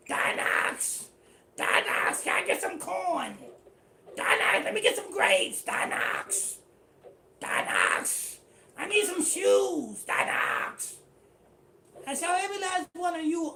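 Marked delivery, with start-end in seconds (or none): 0.1-11.0 louder, voiceless rasp, pleading for handouts; begging like Donox. Pretend. The unrepentant begging the rulers of Israel, loud voiceless rasp. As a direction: beg the rulers of Israel like a broke man on the corner. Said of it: "gonna come back like Donox".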